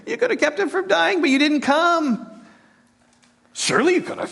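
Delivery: a scoffer laughing at Jesus, very high; laughing mockery. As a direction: taunt him with a scornful laugh. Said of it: "some skepticism".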